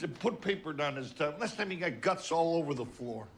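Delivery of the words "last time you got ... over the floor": ordinary delivery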